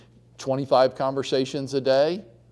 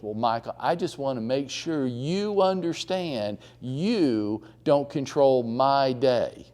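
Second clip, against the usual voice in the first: slow firm Diane; slower than usual. Retold or imitated.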